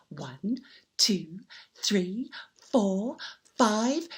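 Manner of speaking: hushed count; whispered counting. A narrator's reading. Storyteller whispering the coin count. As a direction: count softly.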